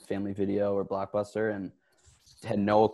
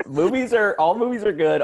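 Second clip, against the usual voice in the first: much higher and louder, laughing; 11 semitones higher, 7 dB louder than usual. Real, not acted. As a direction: blurt it out while cracking up. Laughing joke reply.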